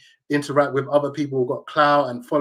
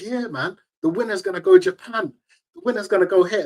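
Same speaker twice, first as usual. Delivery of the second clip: put-on Cockney accent; 8 semitones higher than usual.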